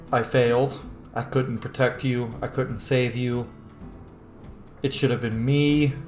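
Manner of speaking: softly voiced guilt, understated; grieving inner thought. Player voices a brother's thoughts as his sister died, quiet grief. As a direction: murmur it low, as quiet self-blame.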